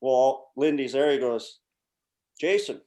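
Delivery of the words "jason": 1 stern coach. Retold or imitated. Coach calling out player.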